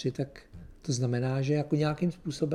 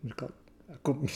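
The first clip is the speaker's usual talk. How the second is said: normal talking voice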